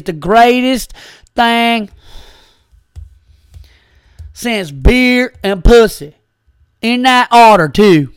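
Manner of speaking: cocky mock Texan bragging about football; Texan accent. Mocking Texan football fans on the road, hammed-up Texan accent. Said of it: "Bill and I were doing accents".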